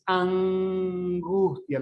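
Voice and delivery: hugely stretched velar "ang" in "angustia"; velarized n. Shown as a demonstration. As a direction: hum on the back n of "angustia" before the g. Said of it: "La lengua no llega a tocar en los alveolos".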